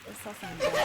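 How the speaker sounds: high, laughing through the words. Real, not acted. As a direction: burst out laughing mid-phrase. Repeats phrase, second time laughing.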